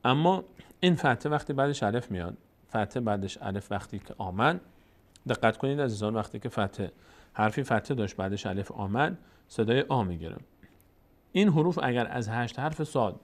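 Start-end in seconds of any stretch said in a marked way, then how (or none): none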